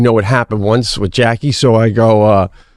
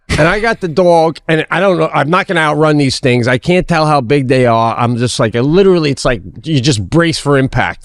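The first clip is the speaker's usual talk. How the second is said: usual speaking voice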